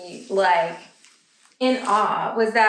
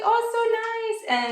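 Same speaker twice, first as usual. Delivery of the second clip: cooing shopper; 12 semitones higher, slower than usual. Retold or imitated. Store lady admiring it.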